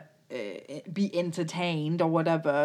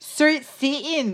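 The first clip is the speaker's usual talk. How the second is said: high, drawn-out mock Newcastle; hammed-up Geordie accent; 7 semitones higher, slower than usual. Joking over Jade's line, exaggerated Geordie accent. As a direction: stretch out a silly Newcastle accent, slowly.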